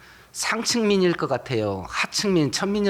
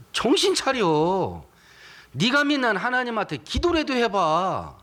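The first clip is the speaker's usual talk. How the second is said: taunting, climbing higher toward the end; mocking neighbour; 7 semitones higher than usual. Unbelieving neighbour taunting the churchgoer, mocking and rising. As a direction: sneer at the believer, rising higher. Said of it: "나에게 뭐라고 말하냐면".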